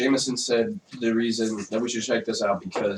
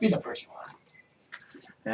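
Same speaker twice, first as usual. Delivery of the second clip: soft, slow unvoiced whisper; 12 dB quieter, much slower than usual. Real, not acted. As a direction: whisper it as a tease. Teasing whispered aside.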